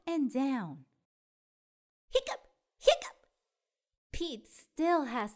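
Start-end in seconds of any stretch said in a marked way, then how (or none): 2.1-3.3 hiccup twice, punching the second. Acted. Pete still has hiccups.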